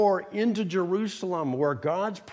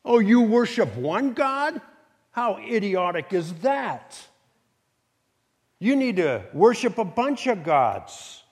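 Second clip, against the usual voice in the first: mock taunting, pitched up; scornful world; 4 semitones higher, 5 dB louder than usual. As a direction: jeer at a puny rival. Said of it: "what did the world say".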